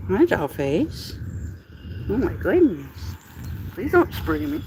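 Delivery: high cooing, slow start; sweet pleading pet voice. Real, not acted. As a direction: coo and plead with the cat. Baby talk to a cat.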